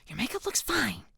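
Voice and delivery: high, exasperated reply; frustrated huff. A narrator's reading. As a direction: huff it out, pitch jumping up. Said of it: "I huff in frustration".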